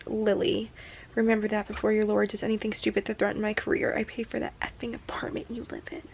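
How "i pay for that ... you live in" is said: soft reading of a cruel line; whispered reading. Retold or imitated. Reading his threat aloud under her breath.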